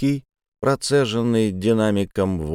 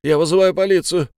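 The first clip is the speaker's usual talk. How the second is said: panicked shout; 8 semitones higher, 3 dB louder than usual. Acted. Clerk panicking behind door.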